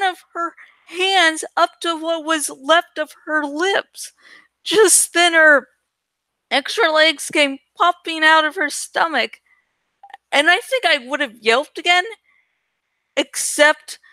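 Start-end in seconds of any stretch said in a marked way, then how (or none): none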